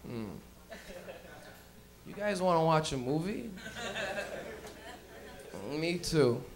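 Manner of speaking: low, soft, laid-back teacher; stoned teacher. Retold or imitated. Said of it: "he'd look at you and he'd be like".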